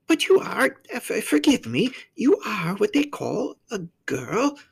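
high faun voice, feeling for the words; apologetic, high-pitched creature asking carefully; polite, hesitant faun. A narrator's reading. Faun checking that the child is a girl, high and tentative.

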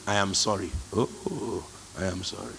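soft apology. Retold or imitated. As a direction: let it slip out quietly. Apologising easier, softly.

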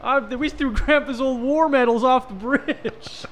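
high, slow Southern drawl, hammed up. Continues mock Southern voice.

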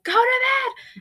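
sudden high, loud yell; yelling at kids. Retold or imitated. Fed-up dad yelling at his boys to bed. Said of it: "after I've been screaming at them".

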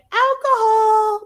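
high, slow, loud cheer; excited shout. Retold or imitated. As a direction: whoop it out like a party girl. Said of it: "she's like".

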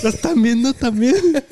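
high, loud and laughing; laughing question. Real, not acted. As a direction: ask it while cracking up. Laughing about the scare.

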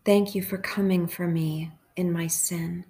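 hushed, even prayer with long pauses; guided meditation prayer. Real, not acted.

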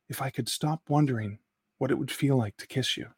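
soft, breathy tease; quiet. Acted. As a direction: murmur it close. Intimate line before kiss.

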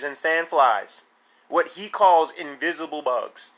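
normal talking voice

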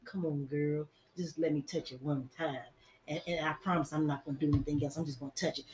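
low, hushed, insinuating murmur in the ear; coaxing whisper. Acted. Little man sweet-talking a girl into letting him, whispering. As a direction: coax softly and low, as if sharing a secret. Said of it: "the little man whispering in your ear".

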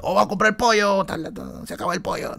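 the cook calls to a customer that the chicken is running out; cook shouting. Retold or imitated. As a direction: yell it across the counter. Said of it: "Y el chino grita".

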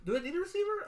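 high-pitched quoted question; partner's question. Retold or imitated. Host mimics partner new to the draft, high-pitched question.

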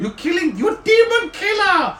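high, thrilled gnome exclaiming; excited. Acted. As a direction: marvel at a demon slayer. Zanlin amazed, calling out.

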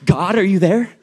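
loud, high frantic phone talk; mock panic, half laughing; panicked. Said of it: "I was like".

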